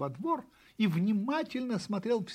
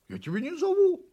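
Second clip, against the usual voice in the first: high, loud denial; protesting puppy; 13 semitones higher, 7 dB louder than usual. A narrator's reading. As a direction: insist loudly in a high puppy voice. (щенок громко возражает)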